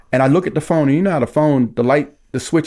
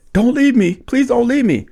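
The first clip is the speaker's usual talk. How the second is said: high-pitched imitation of her begging; retold pleading; 8 semitones higher than usual. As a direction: act out someone begging not to be abandoned. Lisa begging him to stay.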